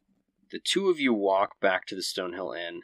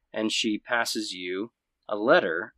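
usual speaking voice

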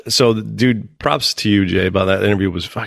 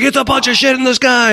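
much higher, worked-up mock alarm; excited buddy imitation. Buddy reporting lights over the pool, high excited imitation. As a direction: imitate a friend amazed at the sky.

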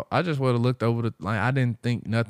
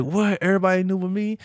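high-pitched incredulous question; mock shock, voice pitched up; retold disbelief; 10 semitones higher, faster than usual. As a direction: imitate him finding out last. Retells his disbelief.

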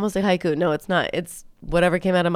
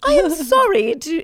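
high, put-on British accent; affected British accent; 10 semitones higher, 3 dB louder than usual. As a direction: apologise in a mock British accent. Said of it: "it's my affected British accent".

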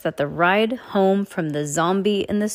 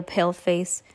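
plain reading voice

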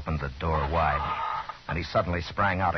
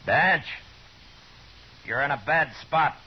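calling out; 9 semitones higher, 3 dB louder than usual. Acted. Marshal calls to trapped outlaw.

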